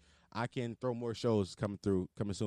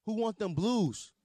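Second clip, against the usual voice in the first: hype intro; 7 semitones higher than usual. Retold or imitated. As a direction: pump up the crowd. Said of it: "I was like".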